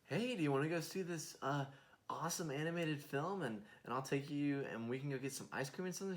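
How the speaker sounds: a hint of a cheery, overeager sibling; eager big brother. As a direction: pitch it up like you're trying hard to win the kid over. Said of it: "I'm gonna go".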